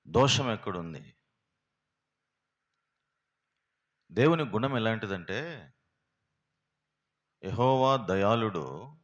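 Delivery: loud, ringing sermon proclamation; full-force preaching. Acted. Preacher proclaiming God's mercy to the congregation, loud and fervent. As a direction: proclaim it to the whole hall.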